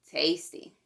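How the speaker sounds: plain reading voice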